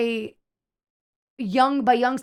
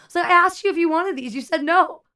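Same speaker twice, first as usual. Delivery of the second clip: higher, louder pointed retort; exasperated; 7 semitones higher, 6 dB louder than usual. Retold or imitated. Server scolding customers who said no, exasperated. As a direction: throw their answer back at them.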